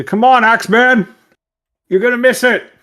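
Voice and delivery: high, loud in-character call. Acted. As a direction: holler down the hallway to a straggling companion. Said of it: "Hamrick's there to call out to".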